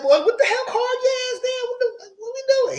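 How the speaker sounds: high, laughing, teary squeal; shocked squeal. Real, not acted. Viewer scolding the fighters, high and teary. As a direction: squeal it in disbelief.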